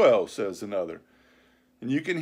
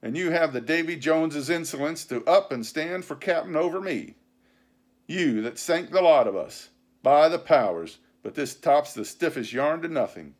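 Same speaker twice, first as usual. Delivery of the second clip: loud, raised pirate tirade; vehement; 5 semitones higher, 6 dB louder than usual. A narrator's reading. Silver answering the mutineers' charges, vehement. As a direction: pound out the argument indignantly. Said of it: "talking with a vehemence that shook the house".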